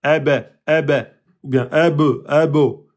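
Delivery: "eb, ebo" with the jaw opening into a vowel; wrong vowel-release demo. Shown as a demonstration. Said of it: "sinon vous allez sortir une voyelle".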